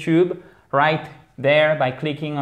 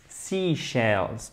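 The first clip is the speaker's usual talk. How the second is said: "Seashells" softly repeated, stress on the first syllable; careful, quieter "Seashells", first part strongest; 3 semitones lower, 7 dB quieter than usual. Shown as a demonstration. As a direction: stretch the "Sea" in "Seashells". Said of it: "faut bien faire l'accent tonique sur la première syllabe".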